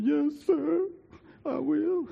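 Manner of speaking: high, small obedient voice; meek boy. Retold or imitated. Son answers his father, high and meek. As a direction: answer like a chastened kid.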